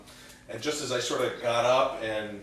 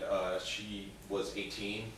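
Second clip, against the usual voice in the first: usual speaking voice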